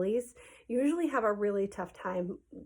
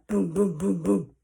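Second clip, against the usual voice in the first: booming knocks voiced by mouth; imitated door pounding; 5 dB louder, slower than usual. Storyteller mimics someone banging on the door, heavy booms. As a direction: pound out each boom separately.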